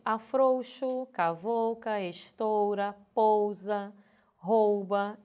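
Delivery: "afrouxo, cavalca, estoura, pousa, rouba" com OU fechado; pronúncia correta. Shown as a demonstration. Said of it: "Se você pronunciar corretamente". ("afrouxo, cavalca, estoura, pousa, rouba" com o U audível)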